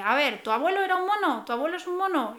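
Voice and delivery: teasing, sneering question; mock the kids about their grandpa. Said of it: "decían así como en tono burlón". (tono burlón y algo agudo)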